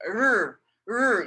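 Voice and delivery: r held as a buzzing sound, tongue suspended behind the teeth; Chinese r sound. Shown as a demonstration. Teacher demonstrating the Chinese word for sun. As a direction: sound out the r slowly for the class. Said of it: "and you just go r".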